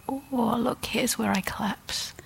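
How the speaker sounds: soft, high, gentle discovery; hushed, lifted voice of tender self-acceptance; compassionate noticing. Acted. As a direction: notice it kindly, as if to yourself. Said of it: "we can compassionately meet our limit".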